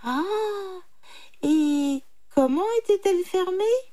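high, naive question; innocent jackal. A narrator's reading. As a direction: ask it high, playing simple. (chacal faussement naïf, voix aiguë)

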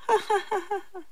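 high mocking titter; jackal's laugh. A narrator's reading. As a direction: laugh rapidly at the trapped victim. Jackal laughs at trapped tiger. (ricanement aigu de chacal)

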